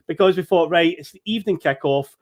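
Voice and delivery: ordinary talk, nothing marked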